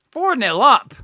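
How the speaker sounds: loud high shout of the score; excited shout. Real, not acted. Fan shouting the score at his watch-along. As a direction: yell it out in delight.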